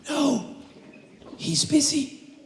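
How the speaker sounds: put-on high, hushed little-kid voice; a small child guarding the phone; child's voice. Child tells the caller his mother is busy, high and hushed.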